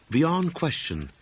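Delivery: plain reading voice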